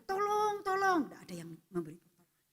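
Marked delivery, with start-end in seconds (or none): 0.0-1.1 high mock cry of tolong; calling for help. Man hanging from a branch calls for help, high-pitched. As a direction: call out for help from the dark. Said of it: "Dia mulai berteriak-teriak".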